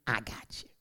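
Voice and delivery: low and soft, intimate; Jesus comforting quietly; tender low reassurance. Acted. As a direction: say it like a hand on the shoulder. Voicing Jesus's promise tenderly.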